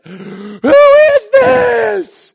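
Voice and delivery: shrieked, very high and loud; full-out imitated shriek; blood-curdling scream. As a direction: scream it out like a terrified girl. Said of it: "just blood-curdling. And then she let out this".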